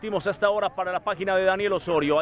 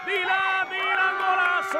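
high excited goal cry; goal shout; 12 semitones higher, slower than usual. Real, not acted. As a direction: yell the goal out.

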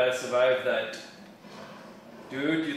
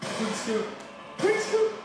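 building excited shouts; 7 semitones higher, slower than usual. Real, not acted. Repeated gaming callout, building.

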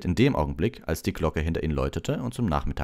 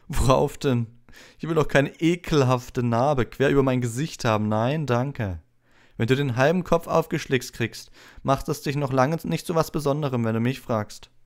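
haughty, higher-pitched disdain; snooty raised schoolboy voice; scornful Malfoy; 10 semitones higher, 5 dB louder than usual. A narrator's reading. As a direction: belittle him with lofty contempt. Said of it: "sagte Malfoy".

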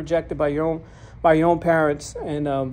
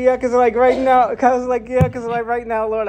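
laughing protest; 6 semitones higher, 5 dB louder than usual. Retold or imitated. Retold protest to God, laughing.

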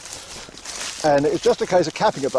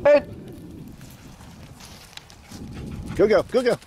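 much higher recall and praise; calling the dog; 9 semitones higher, 3 dB quieter than usual. Real, not acted. Owner recalling Lola and praising her in a high voice.

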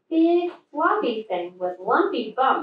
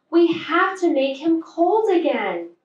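high, louder and urgent; rally the friends in a hurry; 7 semitones higher, 4 dB louder than usual. A narrator's reading. Little Bear urges friends to save the snowman, urgent.

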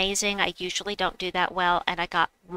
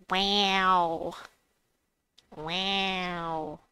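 imitated wow; 2 semitones lower, 3 dB quieter than usual. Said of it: "I need to listen to him do it".

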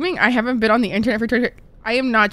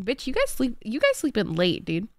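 ordinary delivery

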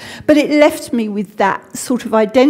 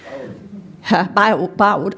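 attempted Irish accent; slower than usual. Shown as a demonstration. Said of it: "I can't do the Irish accent".

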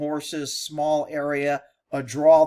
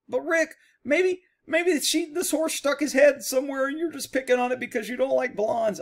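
mock critic; 8 semitones higher than usual. Imagined critic defending the woman.

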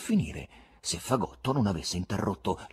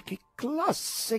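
higher, delighted exclamation; rapt cry; 14 semitones higher, 4 dB quieter than usual. A narrator's reading. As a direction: cry out in wonder. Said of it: "gridavano rapiti".

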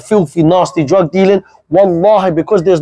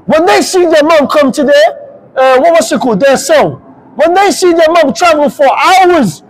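raised, driving sermon voice, pushing each line harder; fiery preaching; 5 semitones higher than usual. Acted. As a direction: preach it hard to the youth, no apologies. Preaching at full force.

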